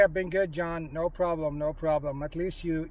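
usual speaking voice

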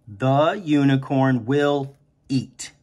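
unicorn will eat said very slowly; slow rereading. Shown as a demonstration. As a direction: drag the words out while writing. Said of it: "we say the word over and over".

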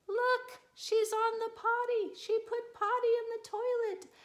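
high, eager calling out; excited announcement. Acted. Brother telling Mom the news, excited and high. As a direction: call everyone to look, thrilled.

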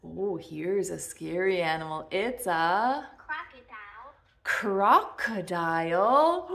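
usual speaking voice

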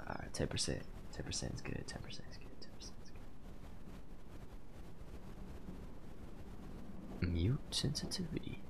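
hushed unvoiced whisper. Real, not acted. Streamer whispering so the game won't hear. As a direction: keep it to a breath. Whispering to keep mic low.

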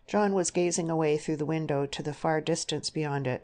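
ordinary delivery, nothing marked

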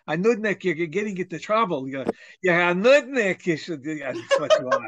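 put-on a light Yiddish accent, scolding. Grandparent-style scolding of a nudnik, in a Yiddish accent. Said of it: "it's important to have the accent".

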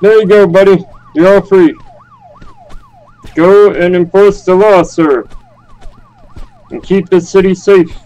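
lightly voiced confused grandpa; old man voice. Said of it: "the confused old man around the server".

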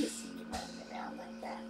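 soft whisper, barely voiced. Real, not acted. Owner whispering fondly to her tired pet, hushed. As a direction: breathe it to a sleepy pet.

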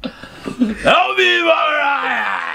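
mock cry for help. Playful cartoon cry.

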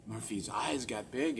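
normal talking voice

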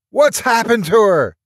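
high, loud alarmed shout. A narrator's reading. Mark shouting down at the people in the garden. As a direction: Mark yells down from the window. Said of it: "he shouted".

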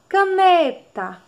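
"Caneta" slowly, NE high and loud; "Caneta" with a lengthened, emphatic NE; stressed middle syllable. Shown as a demonstration. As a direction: stretch and lift the NE in "Caneta". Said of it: "qual é a sílaba mais forte".

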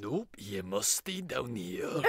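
low, slow, hammed-up Russian accent; bad Russian accent. Teasing co-host about the octave, deep bad Russian accent. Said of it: "Can you speak Russian in this octave?".

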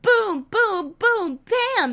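high cartoon cannon fire; cannon booms. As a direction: shout the cannon shots like a kid playing. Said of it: "the cannon said".

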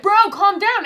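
imitating Spider-Man. Retelling the hero talking to a villain, loud imitation. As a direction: call it out high, as if settling someone down. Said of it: "Spider-Man's just like".

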